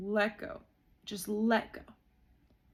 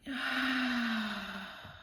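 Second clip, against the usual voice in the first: long relieved exhale. Real, not acted. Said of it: "Gosh, that feels so good".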